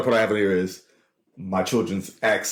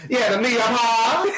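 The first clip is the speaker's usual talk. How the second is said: imitating daughter; 11 semitones higher, slower than usual. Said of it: "She said".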